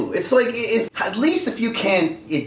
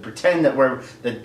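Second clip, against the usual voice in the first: ordinary talk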